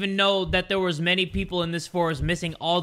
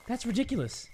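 muttered; 2 semitones higher, 9 dB quieter than usual. Real, not acted. Said soft then shouted.

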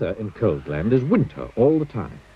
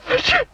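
high sneeze; 3 dB louder than usual. Acted. Sneezing while walking.

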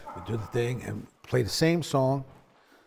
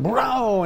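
a loud, higher "Brow" mimicking the bass entering; imitated bass note; 8 semitones higher, 6 dB louder than usual. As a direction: make the bass line's sound with your voice.

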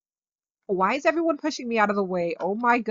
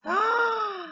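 sharp, high gasp of delight; excited gasp; 13 semitones higher, 4 dB quieter than usual. Real, not acted. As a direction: gasp as if you just spotted a treasure. Reacts to a new item.

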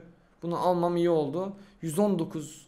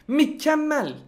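Mükemmel pitched high and loud; high, forceful stress on Mükemmel; emphatic MÜKEMMEL; 8 semitones higher, 5 dB louder, slower than usual. Real, not acted. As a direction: punch out Mükemmel as one raised exclamation. Insisting on the word perfect.

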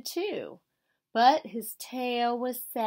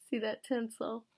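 plain reading voice

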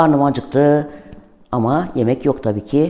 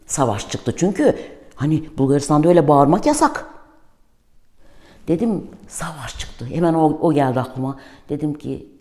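ordinary talk

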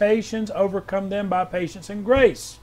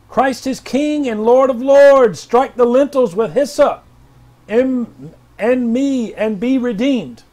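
higher, louder, commanding declaration; prophetic proclamation; 4 semitones higher, 5 dB louder, slower than usual. Acted. Pastor reading R J W's word, loud and commanding. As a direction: thunder it out like a prophet.

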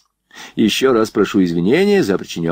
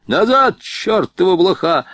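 high, furious bark; angry yell, pitched way up; barked order; 10 semitones higher than usual. A narrator's reading. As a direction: bark a command to come back, cursing. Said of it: "рявкнул поручик".

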